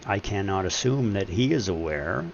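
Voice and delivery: normal talking voice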